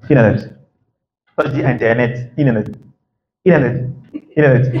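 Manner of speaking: Internet repeated as inanet, t dropped; American flap T. Shown as a demonstration. Teacher drilling the American Internet for learners. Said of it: "le flap T".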